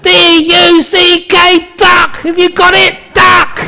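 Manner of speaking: mock-furious shouted lesson; exasperated shouting. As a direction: hammer it home at a hammed-up scream. Husband spells it out, shouting.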